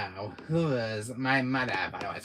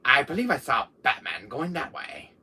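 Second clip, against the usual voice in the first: usual speaking voice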